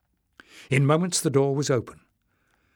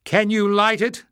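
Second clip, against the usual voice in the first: calling out; 9 semitones higher, 5 dB louder than usual. A narrator's reading. Calling across water.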